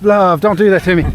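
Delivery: startled, calling her back from the edge; scared husband calling his fiancée back; alarmed plea. Real, not acted. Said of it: "she's gonna give me a heart attack".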